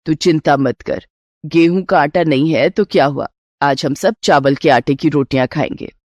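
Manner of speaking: calm her worries in a low mother-in-law voice; reassuring mother-in-law. Acted.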